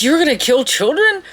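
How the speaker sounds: high, appalled question; mock horrified incredulity; acted disbelief. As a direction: ask it as a shocked captive. Captive reacts in disbelief.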